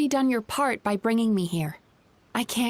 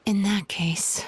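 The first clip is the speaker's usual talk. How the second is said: slow whispered thought; whispered aside; slower than usual. Acted. Mizuki thinking to himself, slow whisper. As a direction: murmur it to yourself, slow.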